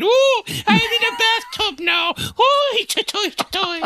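high-pitched, silly mock Irish voice; hammed-up imitation of a bad movie Irish accent; weird Irish accent. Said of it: "talking in a weird Irish accent and going".

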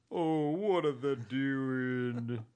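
slow drawn-out Southern gentleman. Retold or imitated. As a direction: stretch it out like a genteel Southern man. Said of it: "read it in a Southern voice".

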